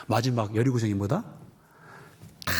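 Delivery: ordinary talk, nothing marked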